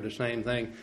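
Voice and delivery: ordinary talk, nothing marked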